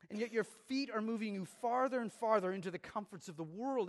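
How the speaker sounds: preaching at full force. Acted. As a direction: build it into a rousing warning from the pulpit. Impassioned preaching builds.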